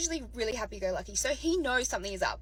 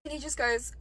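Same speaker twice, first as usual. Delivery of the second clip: usual speaking voice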